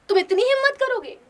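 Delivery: high, loud, outraged question; indignant challenge. Acted. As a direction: dare him to try. Natalia challenges Lomov.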